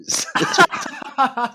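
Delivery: high, loud laughing sanitary pad. Real, not acted. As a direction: squeal the words through laughter. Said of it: "She was saying sanit".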